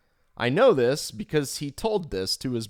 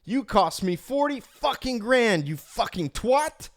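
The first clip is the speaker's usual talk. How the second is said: screaming boss; 10 semitones higher than usual. Retold or imitated. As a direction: yell it at him across the trading floor. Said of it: "which led to him screaming".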